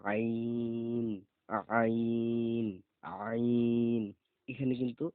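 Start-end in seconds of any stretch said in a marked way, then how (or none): 0.1-4.0 আইন pressed from the throat, growing louder; teacher demonstrates a pressed-throat আইন; throaty ain. Shown as a demonstration. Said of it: "গলাতে চাপ দিয়ে উচ্চারণ করতে হবে".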